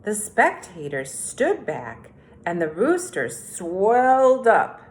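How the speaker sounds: storybook read-aloud, unhurried and clear; reading to children. A narrator's reading. Teacher reading The Tortoise and the Hare to third graders, storytelling. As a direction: read it like story time on the rug.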